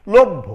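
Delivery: লভ্য loud, doubled bh; loud teaching example; pronounce lobbho. Shown as a demonstration. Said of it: "এবার দেখো".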